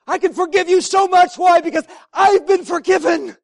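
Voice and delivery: loud, exultant declaration of forgiveness; joyful preaching. Acted. Forgiven pastor inviting the congregation to offend him, exultant preaching. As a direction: dare them to offend you, beaming.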